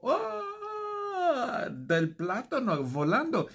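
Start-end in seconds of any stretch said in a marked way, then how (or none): 0.0-1.7 very high scream as he jumps; falling scream. Retold or imitated. Jeffrey leaping off the flying banana, high scream. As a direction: yelp while jumping out. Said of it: "yo me tiro".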